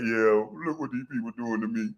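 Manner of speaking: hammed-up self-pity; mock sad whine. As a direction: play the wounded victim, mocking. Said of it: "come back on camera looking sad".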